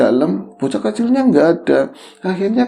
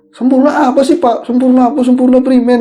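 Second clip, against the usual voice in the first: higher and louder, puzzled and insistent; startled question; 9 semitones higher, 4 dB louder than usual. Retold or imitated. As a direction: blurt it out, baffled by the ghost. Boy asks the ghost, startled. (anak kecil bertanya kepada hantu, heran dan agak keras)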